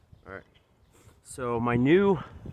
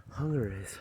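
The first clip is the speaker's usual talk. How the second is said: ordinary talk, nothing marked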